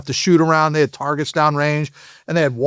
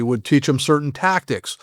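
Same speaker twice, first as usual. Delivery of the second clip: normal talking voice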